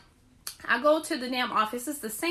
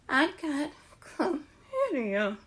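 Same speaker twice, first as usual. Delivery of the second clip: mock dejected confession; 2 semitones higher, much slower than usual. Confessing to boyfriend in lobby.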